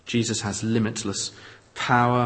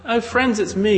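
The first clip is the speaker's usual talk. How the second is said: much higher, louder glad hello; friendly greeting call; 9 semitones higher, 5 dB louder than usual. Retold or imitated. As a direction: call out brightly to old friends. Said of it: "you didn't cry out".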